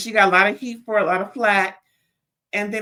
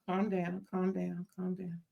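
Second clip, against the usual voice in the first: very soft, fading repetitions; self-soothing; 13 dB quieter than usual. Real, not acted. Reviewer catching herself mid-rant, softly calming down. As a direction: talk yourself down under your breath.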